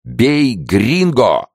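high, drawn-out mob shout; battle cry. A narrator's reading. Mob storms into Henry's cell, shouting a battle cry. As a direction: yell it slowly as a war cry. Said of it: "неизменный боевой клич латиноамериканцев".

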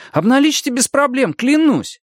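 very high, hurried pleading; eager jabbering. A narrator's reading. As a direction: gabble it eagerly in a high boyish voice. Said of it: "затараторил Арни". (тараторит высоким, умоляющим голосом)

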